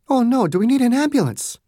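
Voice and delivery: a quick worried question in the woman's voice; doctor hearing of a fight, mildly alarmed; alarmed Margaret. A narrator's reading.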